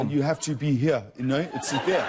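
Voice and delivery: South African accent, amused; attempted South African accent. Shown as a demonstration. Interviewee asked to do a South African, playful accent demo. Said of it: "Can you do a South African?".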